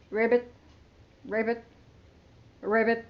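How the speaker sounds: low croaking ribbits; low frog croak. Shown as a demonstration. Teacher showing the kids how a frog sounds, low croaks. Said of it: "How does the green frog sound?".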